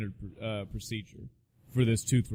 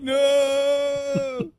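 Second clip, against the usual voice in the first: much higher, louder mock cry of alarm; mock yelp; 14 semitones higher, 7 dB louder than usual. Patient yelping at the surgeon's joke. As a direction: yelp in pretend horror.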